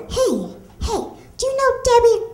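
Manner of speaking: high, quick goofy guy voice; a hint of a dopey guy calling out; lightly voiced eager stranger. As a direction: play a overeager dim stranger. Stranger taps her in line.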